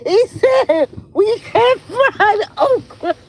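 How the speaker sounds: high-pitched, helpless laughter through the words; real laughing fit breaking into the speech; laughing hard. Real, not acted. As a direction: squeal the retold line while cracking up. Cracking up retelling the quote.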